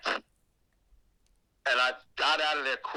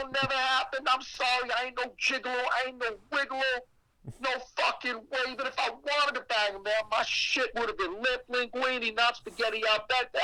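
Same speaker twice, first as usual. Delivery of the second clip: frantic refusal; 10 semitones higher than usual. Retold or imitated. His quoted refusal, high voice.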